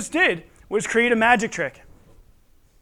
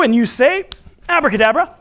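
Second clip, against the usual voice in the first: put-on kids party magician; 5 semitones higher, 6 dB louder than usual. Leading kids to chant.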